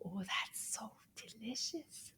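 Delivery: whispered delight; hushed, savouring whisper. Pretend. Puppet whispers about the tasty fruit.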